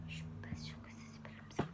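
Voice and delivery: quick whispered aside; hurried whisper, unsure of herself. Real, not acted. Youtuber doubting the outfit's name, fast whispered aside.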